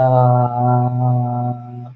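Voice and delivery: very low sustained "uh"; deep, relaxed vocal tone; low sung note. Shown as a demonstration. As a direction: drop to your lowest note and hold it. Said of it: "I was able to go lower".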